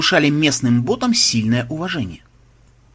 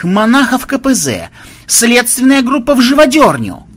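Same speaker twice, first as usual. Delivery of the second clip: barked orders; 9 semitones higher, 6 dB louder than usual. Acted. Investigator gives orders.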